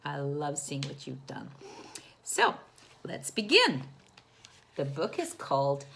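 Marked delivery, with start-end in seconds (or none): none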